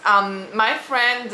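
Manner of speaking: ordinary talk, nothing marked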